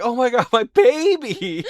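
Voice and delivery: high, loud mock wail of panic. Husband imitating his wife's panic over the trapped toddler, loud high mock wail. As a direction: ham up a frantic mother. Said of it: "she is freaking out so much".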